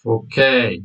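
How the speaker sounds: ordinary delivery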